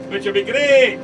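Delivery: hammed-up, bad Scottish accent; terrible Scottish accent. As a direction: badly fake a Scottish accent. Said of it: "that was a terrible Scottish accent".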